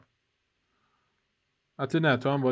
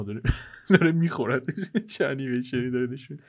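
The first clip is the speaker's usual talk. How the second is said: laughing. Real, not acted. Watching mimic eat him.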